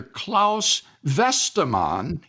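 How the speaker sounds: Klaus Westermann with a German-style Klaus and Westermann; German pronunciation. Real, not acted. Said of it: "leaning into his attempt at the German pronunciation".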